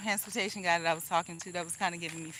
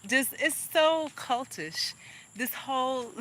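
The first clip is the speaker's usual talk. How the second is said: high, slow, laughing exasperation; amused disbelief, drawn out; 6 semitones higher, slower than usual. Real, not acted. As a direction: say it through a laugh, higher.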